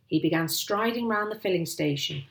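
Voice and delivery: plain reading voice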